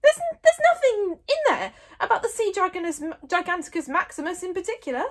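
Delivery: high, loud, stammered disbelief; incredulous question. A narrator's reading. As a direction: stumble into a baffled question. Stoic baffled by the brief book.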